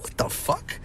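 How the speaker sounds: breathless whispered disbelief. Retold or imitated. Son reacting to mom, whispered shock. His own confused reaction.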